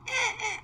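high squeaky mock car lurch; mimicking a car lurching to a stop; car rocking noise. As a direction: bounce the sound up like a car rocking to a halt.

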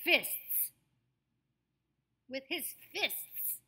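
emphatic FISTS with the T clear between two hissed S's; crisp STS ending. Shown as a demonstration. Coach demonstrates for students, FISTS sharply articulated. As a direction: land hard on every consonant closing FISTS. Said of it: "See if you can make that happen".

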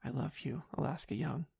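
very soft, hushed confession; tender whisper. A narrator's reading. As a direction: murmur it to a sleeper. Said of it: "I whispered".

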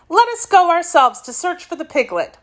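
high-voiced, mildly anxious girl; a touch of worry, pitched well up. A narrator's reading. Little girl proposing a search for the piglet, anxious. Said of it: "she said anxiously".

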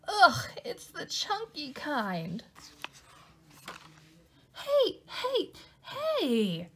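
calling out the culprit; outraged. Acted. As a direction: Louie protests the peanut butter smears. Louie outraged by more mess.

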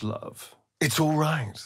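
normal talking voice

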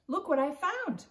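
put-on boy calling his sister over. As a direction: call her over excitedly. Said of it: "Jack called".

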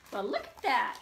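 ordinary delivery, nothing marked

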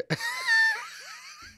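high helpless cackling laugh; burst of laughter. Real, not acted. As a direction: crack up at the silly name. Cracking up at username.

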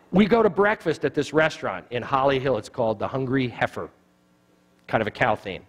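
normal talking voice

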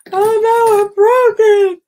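high, loud cry of dismay, slowing on the last words. Real, not acted. Showing viewers the bracelet she just broke, high dismayed cry. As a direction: wail that it snapped.

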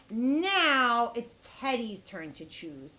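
ordinary talk, nothing marked

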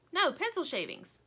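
high, stubborn correction; arguing toy. A narrator's reading. Lumpy keeps up the quarrel, stubborn. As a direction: insist with a high, falling tune. Bickering toys contradict each other.

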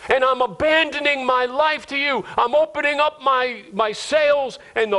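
high-pitched, impassioned prayer; fervent surrender prayer. Acted. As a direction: pray aloud, giving your whole life over.

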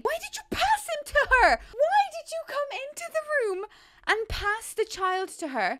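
very high, loud outraged yell; exasperated scream. Real, not acted. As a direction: scream it at the screen. Yelling at sim passing baby.

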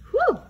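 high, loud whew of relief; relieved whistle-like whew. Acted. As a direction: blow out a big squeaky whew of relief. Bear relieved, no Mouse.

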